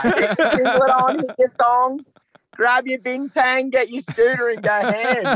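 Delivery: high, slow broad Aussie bogan voice; broad Australian accent. Pretend. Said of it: "Do you reckon I sounded pretty bogan then?".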